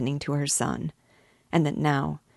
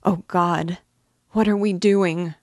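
high, louder anguished cry; 3 semitones higher, 7 dB louder than usual. Acted. Mother crying out to God as her son kneels. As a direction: she breaks at what is happening.

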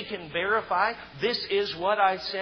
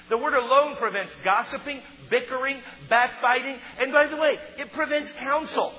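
raised, pressing sermon voice; fervent preaching; 5 semitones higher than usual. Acted. Pastor urging the congregation to take the counsel of Jesus. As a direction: drive each point home from the pulpit. Preacher builds up to full force.

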